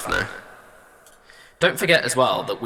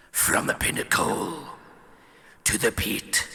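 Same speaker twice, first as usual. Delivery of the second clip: 1 gritty villain whisper; 4 dB quieter than usual. Shown as a demonstration. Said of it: "Yeah, that's better".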